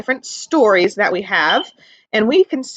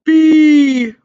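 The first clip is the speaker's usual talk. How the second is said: louder, different bee voice; 3 semitones higher, 7 dB louder than usual. Acted. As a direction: announce yourself like a little bee. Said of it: "it says".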